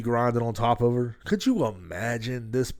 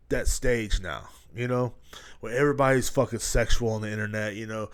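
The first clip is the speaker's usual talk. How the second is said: ordinary talk, nothing marked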